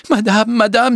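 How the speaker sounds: high, urgent call; panicked maid's call; alarmed cry. A narrator's reading. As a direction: burst in crying out in alarm. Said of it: "s'écria Félicité en entrant".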